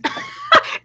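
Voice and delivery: short high-pitched burst of laughter; high squealing laugh. Real, not acted. Catching her own accent slip, high squealing laugh.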